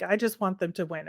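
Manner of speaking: ordinary delivery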